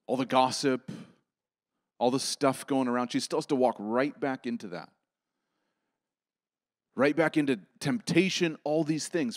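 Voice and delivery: loud, high, driving sermon delivery; impassioned preaching. Acted. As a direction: preach it hard to the congregation. Preaching at full force.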